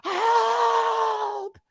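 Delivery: very high shouted plea; desperate cry. Retold or imitated. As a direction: scream for help.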